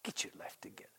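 breathy whispered scolding. Pretend. Telling little Alex softly, whispered. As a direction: whisper it like a secret to a child. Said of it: "how do you say it to little Alex".

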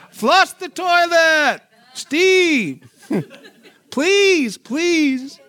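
high, loud, slow, with a laugh; loud comic pleading, stretched out slowly; laughing plea. Retold or imitated. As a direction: beg him through laughter to do it. Retold pleading order to Steve.

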